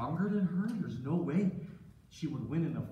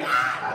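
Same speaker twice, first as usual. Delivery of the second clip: long, loud staged scream; mock scream; 4 semitones lower, 9 dB louder than usual. Wife screams while Joseph looks on, staged scream. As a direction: scream it out and hold it. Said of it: "And then she screamed".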